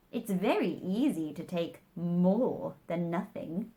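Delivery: a lightly voiced smug Hatter; smug hatter lecturing Alice. A narrator's reading.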